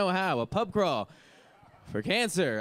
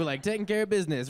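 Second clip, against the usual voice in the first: ordinary talk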